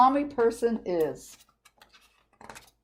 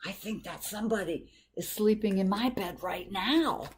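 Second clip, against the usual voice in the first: whispering; 6 dB quieter than usual. A narrator's reading. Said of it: "whispered the little person".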